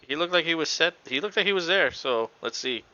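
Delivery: usual speaking voice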